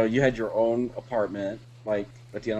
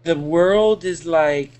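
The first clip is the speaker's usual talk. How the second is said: very slow, higher mock-drunk rambling; imitating drunk friend; 5 semitones higher, 6 dB louder, much slower than usual. Drunk friend going into La La Land, slow mock slur. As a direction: drag it out as a drunk losing the thread. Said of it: "you were so fucking drunk".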